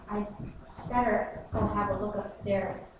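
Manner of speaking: ordinary delivery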